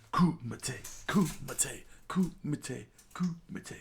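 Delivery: rising each time, ending whispered high; playful repeating. Pretend. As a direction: play with the word, climbing. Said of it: "playing with the word".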